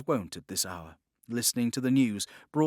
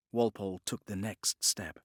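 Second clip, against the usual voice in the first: ordinary delivery, nothing marked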